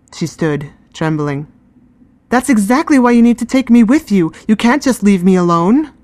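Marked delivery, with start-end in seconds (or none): 2.3-5.9 higher and louder, upset protest; insist, voice raised, close to tears; upset pleading. Acted. Girl upset, arguing with guardian.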